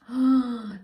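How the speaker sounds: loud high gasp; sharp intake of shock. Acted. Warthogs shocked at themselves, loud gasp.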